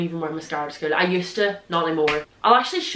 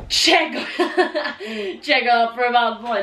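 giggling through the words; laughing; 4 semitones higher than usual. Real, not acted. Showing viewers her old first year bag, laughing. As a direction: crack up while showing the bag.

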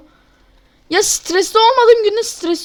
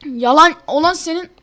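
ordinary talk, nothing marked

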